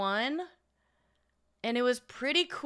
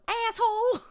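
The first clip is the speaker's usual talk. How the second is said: much higher, louder mock yell; sister's insult; 12 semitones higher, 3 dB louder than usual. Sister cutting off a driver in traffic, high mock yell. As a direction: screech it at the other driver. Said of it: "everybody in our group goes".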